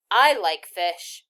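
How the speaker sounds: slow chatter. A narrator's reading. Anne-Marie rambling to the soldier, slow and loud. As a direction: stretch it like a chattering child. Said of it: "she went on".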